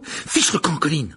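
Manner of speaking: loud, irritated dismissal; angry cry. Acted. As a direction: snap at him to clear off. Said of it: "s'écria Harry avec colère".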